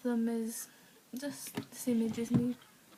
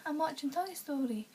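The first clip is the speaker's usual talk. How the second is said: ordinary talk, nothing marked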